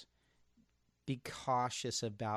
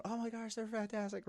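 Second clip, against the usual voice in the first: high, breathy mock gush; lightly hammed-up fan gush; gushing admirers; 11 semitones higher, 6 dB quieter than usual. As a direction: imitate the fawning crowd, much higher.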